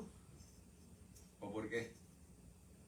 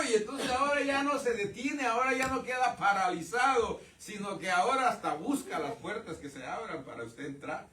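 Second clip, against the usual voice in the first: louder, higher, rising fervour; fired-up preaching; 7 semitones higher, 5 dB louder than usual. Real, not acted. Preacher urging the congregation to boldness, fervent and loud. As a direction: drive it home with growing fire.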